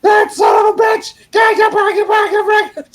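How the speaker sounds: high, loud, sputtering mock rage; hammed-up cussing fit; imitated furious shout. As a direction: mimic a fired-up old man ranting. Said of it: "he's all fired up".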